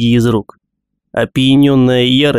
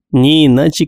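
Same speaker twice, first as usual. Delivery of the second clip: ordinary delivery, nothing marked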